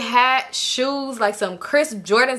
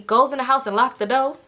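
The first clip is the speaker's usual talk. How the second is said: ordinary delivery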